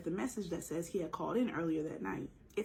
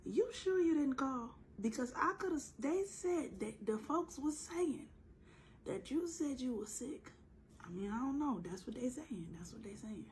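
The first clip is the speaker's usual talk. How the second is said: doubtful supervisor; 5 semitones higher than usual. Acted.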